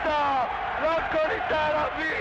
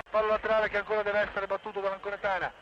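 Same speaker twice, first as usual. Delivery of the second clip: breathless italian goal commentary; faster than usual. Real, not acted. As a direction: rattle off the action quickly. Live play-by-play continues. (radiocronaca rapida e incalzante)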